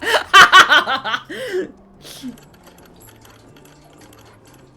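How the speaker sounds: loud, high burst of laughter; real loud laugh. Real, not acted. Laughs after failed accent attempt.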